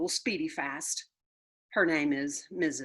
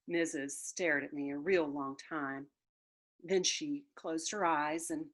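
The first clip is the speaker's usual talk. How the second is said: ordinary delivery, nothing marked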